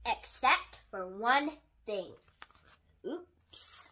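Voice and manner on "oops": whispered oops. Real, not acted. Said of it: "reader slip".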